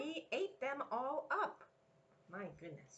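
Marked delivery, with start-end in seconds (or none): 0.0-1.6 Dot complaining. Acted. Parent echoes Dot.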